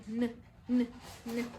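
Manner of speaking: short "n" sounds, not the letter name; isolated letter sound. Shown as a demonstration. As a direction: say "n" alone, evenly spaced. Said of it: "¿Qué sonido?".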